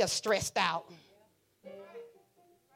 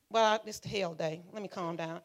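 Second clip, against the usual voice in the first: ordinary talk, nothing marked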